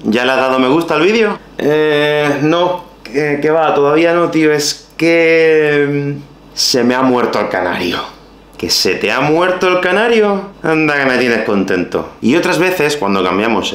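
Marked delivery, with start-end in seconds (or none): 0.0-8.1 sheepish excuse. Acted. Sketch excuse dialogue. 8.6-12.1 mock surprise then dry sarcastic reproach; ironic tone. Teacher acting out an ironic reproach to a friend. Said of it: "si cambiamos un poco el tono de voz".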